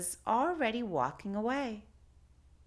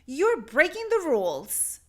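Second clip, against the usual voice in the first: stern librarian; 7 semitones higher, 7 dB louder than usual. Acted. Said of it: "he said to the Lion".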